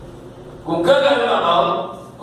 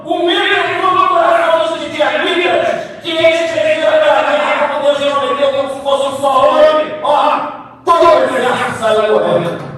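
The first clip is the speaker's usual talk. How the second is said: raised, high-pitched preaching fervour; impassioned preaching; 8 semitones higher, 4 dB louder than usual. Real, not acted. Preacher recounts the Midianites' panic, impassioned and high. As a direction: drive the victory home to the congregation.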